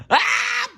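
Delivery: very high yelped hiccup; high hiccup squeak. Acted. Elephant hiccups again at the end, high squeak.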